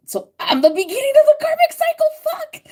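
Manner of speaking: very high, loud, fast squeak of shock; mock realization. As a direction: squeak it as a sudden dawning. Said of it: "I'm like".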